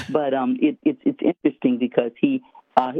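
normal talking voice

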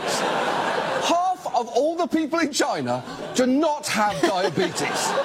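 Half of all the people in China do not have diabetes, drawn out slowly; each word slowed right down; slow incredulous emphasis. Real, not acted. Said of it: "drawn-out incredulous emphasis".

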